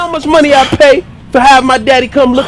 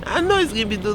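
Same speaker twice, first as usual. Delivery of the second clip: laughing; 4 semitones lower, 4 dB quieter, faster than usual. Acted.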